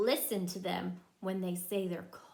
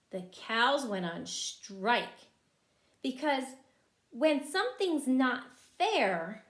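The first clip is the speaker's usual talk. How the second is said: ordinary delivery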